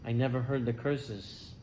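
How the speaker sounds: low and soft, sincere; tender recollection. Acted. Boy tells doctors about his father's reading, tender and soft. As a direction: gently explain the sweetness.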